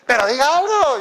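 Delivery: high, loud mock nagging; nagging wife. Wife pressing her silent husband to talk, mock nagging. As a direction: push it out like an exasperated wife. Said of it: "tremenda cantaleta que arma".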